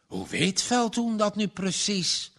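a touch of wonder, lifted pitch. A narrator's reading. Wife asks how Veldhoen knows, mildly wondering. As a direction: ask it half amazed. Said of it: "mevrouw Talma verwonderd".

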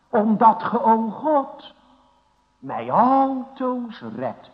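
loud preached plea with altoos stressed; fervent loud plea. Acted. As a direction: cry it out to God from the pulpit. Preacher quotes psalm fervently.

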